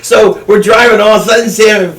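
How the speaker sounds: usual speaking voice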